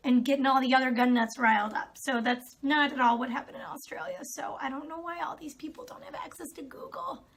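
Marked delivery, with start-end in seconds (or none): 4.2-7.2 breathy sarcastic whisper, higher pitch; whispered aside. Real, not acted. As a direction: whisper it in disbelief.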